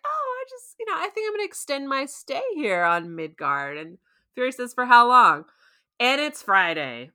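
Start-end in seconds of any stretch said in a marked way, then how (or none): 0.1-3.8 mock-casual, high-pitched excuse; Loki. Acting out Loki extending his stay. 4.7-5.5 loud, pointed question; Fury. Acted. Acting out Fury's pointed question.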